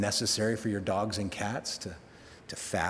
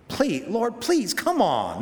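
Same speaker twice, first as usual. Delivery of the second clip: exasperated plea; 9 semitones higher, 5 dB louder, slower than usual. Retold or imitated. Jonah's frustrated prayer.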